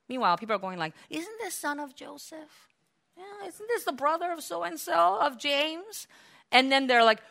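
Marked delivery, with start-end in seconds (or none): 1.1-6.1 high, soft questioning voice; murmuring onlookers. Acted. Townspeople questioning who Jesus is, murmuring. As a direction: wonder aloud in a small, high voice.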